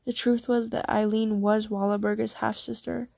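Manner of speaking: ordinary delivery, nothing marked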